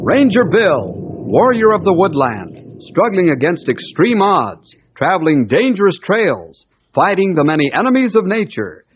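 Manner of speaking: put-on punchy, rolling old-time show intro; dramatic radio announcer. Announcer introducing the guardian of the forest, dramatic. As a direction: announce each danger with ringing emphasis.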